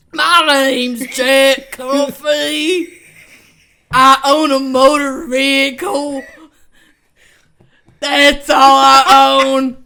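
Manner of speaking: sketch character. Pretend. A lightly voiced sketch character introducing himself. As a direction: announce yourself slowly and proudly. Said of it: "wait, wait".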